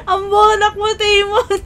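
high scolding through giggles; real helpless laughter. Real, not acted. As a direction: call out a friend's silliness while cracking up. Laughing at friend's blunder.

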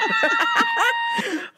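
big high-pitched burst of laughter. Real, not acted. Laughing at own joke.

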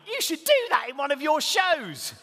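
put-on high, loud, slow cheeky kid voice; laughing child. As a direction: tease dad like a giggling boy. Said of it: "He then laughed and went".

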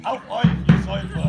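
loud, hammy tavern toast; rowdy bard toast. Acted. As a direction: raise a mug and bellow it. Bard character toasting crowd.

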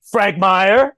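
loud high call of the name; a showman picking someone from the crowd; calling on him. Retold or imitated. Dave calls on the writer first, loud and high.

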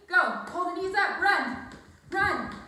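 shouted workout cues, high and loud; shouting. Real, not acted. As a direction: yell it like a hyped trainer. Coach yelling cues mid-workout.

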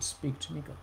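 soft plea to God; hushed prayer. Retold or imitated. Preacher back at his desk, soft plea. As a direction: quietly ask God to speak.

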